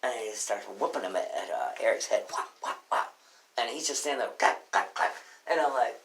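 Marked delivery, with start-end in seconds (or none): 2.3-3.1 voiceless, percussive whop sounds; imitated thwacks. Imitates candy bars hitting head. 4.4-5.1 short voiceless percussive hits; imitated smacks. Imitates repeated hits on head.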